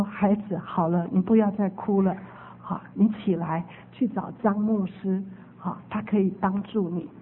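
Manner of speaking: put-on calm a weeping child; gentle comforting voice. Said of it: "说". (低声温柔地安慰)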